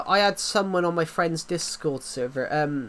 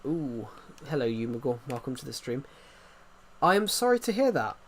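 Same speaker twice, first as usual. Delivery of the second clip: usual speaking voice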